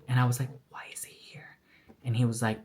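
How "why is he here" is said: unvoiced, frightened whisper; hushed, scared question. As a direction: whisper so the man at the door can't hear. Hiding silently from the brother.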